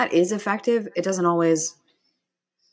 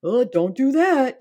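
high, loud, drawn-out mock worry; acted fretful warning, pitched up; worried inner critic; 8 semitones higher, 5 dB louder, slower than usual. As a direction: voice an anxious inner critic pleading, slowly. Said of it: "more fear-based or worry-based or kind of like".

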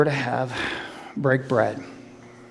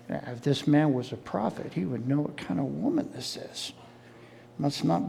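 softer, lower grumble under breath; muttered aside; 6 semitones lower, 12 dB quieter than usual. Acted. Pharisee Simon muttering his doubts to himself, much softer. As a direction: mutter it so no one hears.

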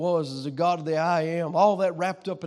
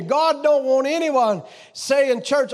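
ordinary delivery

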